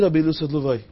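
ordinary talk, nothing marked

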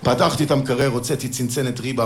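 ordinary talk

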